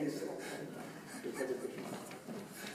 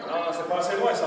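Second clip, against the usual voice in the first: ordinary talk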